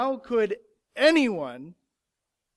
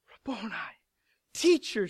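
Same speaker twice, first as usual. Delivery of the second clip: mild exclamation of recognition; raised-pitch cry of recognition, lightly acted; exclaiming; 2 semitones higher than usual. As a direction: exclaim it with a touch of wonder. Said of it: "she exclaims".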